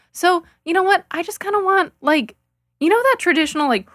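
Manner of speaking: normal talking voice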